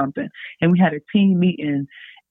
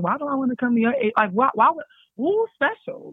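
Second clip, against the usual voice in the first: much higher incredulous whys; a naive college player doubting the agents, higher; higher, puzzled; 7 semitones higher than usual. Retold or imitated. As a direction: question them like a naive kid. Questioning agents.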